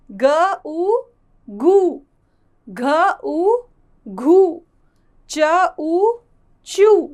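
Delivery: letter, then उ as a long oo, then the drawn-out blend; long oo vowel drill. Shown as a demonstration. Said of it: "छोटी ऊ की मात्रा से उल्टा". (बड़े ऊ का लंबा उच्चारण)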